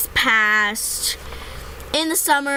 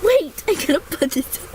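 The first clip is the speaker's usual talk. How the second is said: ordinary delivery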